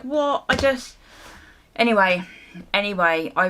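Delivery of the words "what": shocked what. Retold or imitated. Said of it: "I was just like".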